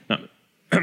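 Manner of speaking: clearing a throat after swallowing wrong; words caught in the throat, choking; choking on saliva. Real, not acted. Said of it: "ça s'étouffe avec sa bave".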